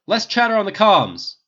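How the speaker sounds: barked order. A narrator's reading. The captain silencing his bickering team, barking. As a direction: bark it to shut everyone up. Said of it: "Rogers barked".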